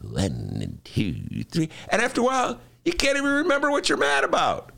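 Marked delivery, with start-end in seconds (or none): 0.0-1.7 slow, soft calming count. Shown as a demonstration. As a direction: count slowly to cool off. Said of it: "count down to ten".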